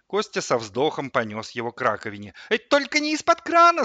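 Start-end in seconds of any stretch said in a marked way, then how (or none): 2.5-3.8 very high, loud panicked squeal. A narrator's reading. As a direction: squeal in protest like an alarmed kettle. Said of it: "заверещал чайник". (истошно вереща)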